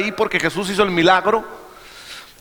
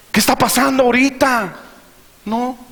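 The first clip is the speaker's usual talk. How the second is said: raised, urgent preacher voicing worried churchgoers; impassioned preaching; 6 semitones higher than usual. Preacher acting out church members in a storm, alarmed preaching.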